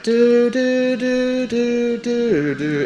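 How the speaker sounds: high sung do-do notes; steady music hum. Retold or imitated. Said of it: "instead of the music going like".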